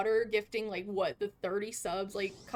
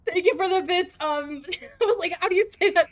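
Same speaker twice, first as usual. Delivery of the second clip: laughing; 6 semitones higher, 7 dB louder than usual. Real, not acted. Overwhelmed, laughing at a gift.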